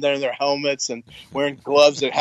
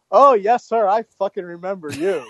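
pointed recognition; 5 semitones higher than usual. Retold or imitated. Range sergeant to lieutenant on the phone, pointedly remembering. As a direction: imitate a sergeant who remembers all too well.

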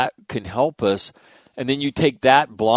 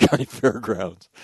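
normal talking voice

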